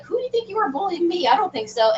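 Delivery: mock-indignant challenge to a bully; feisty retold comeback; indignant retort. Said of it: "I think I just got really mad".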